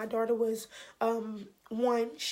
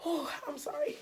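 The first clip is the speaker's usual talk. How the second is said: softer, choked-up apology; real tearful apology; 3 semitones higher, 5 dB quieter than usual. Real, not acted. Apologizing to viewers for crying, tearful. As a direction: apologize through real tears. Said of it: "I told myself I wasn't gonna cry".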